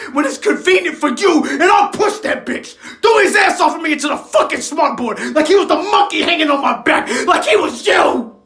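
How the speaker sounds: high, shouted fury; explosive rage. Acted. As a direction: roar it at the absent father. Rage while retelling the shove.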